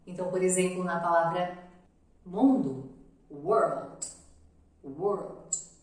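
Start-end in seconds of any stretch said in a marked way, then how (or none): 3.3-5.7 "world" starting with a rounded w sound; English w in world. Shown as a demonstration. Teacher modelling the w of "world". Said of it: "tem o som de W".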